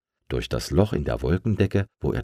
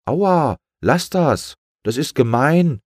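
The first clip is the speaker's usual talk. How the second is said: high, louder ouch and complaint; hurt protest; 8 semitones higher, 5 dB louder, slower than usual. Acted. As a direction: cry out at being pecked. Balloon pecked by gull. (empörtes, hohes Aua)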